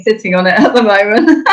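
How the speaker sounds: breaking into real laughter mid-sentence; amused, laughing confession. Real, not acted. Amused admission about her unused ball.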